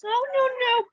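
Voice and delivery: ordinary talk, nothing marked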